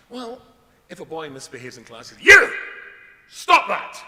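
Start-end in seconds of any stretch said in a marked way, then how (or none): 2.2-4.0 a Scottish teacher barking at a pupil, much higher and louder; Scottish teacher shout. Retold or imitated. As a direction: yell it like a furious Scottish schoolmaster. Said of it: "you do it in a Scottish accent".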